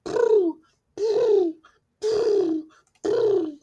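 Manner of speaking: high cooing purr; pigeon coo. A narrator's reading. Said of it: "They say".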